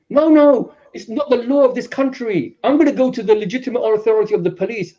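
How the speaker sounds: protesting victim. Acted. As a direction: act out an indignant householder objecting. Acting out victim's indignant protest.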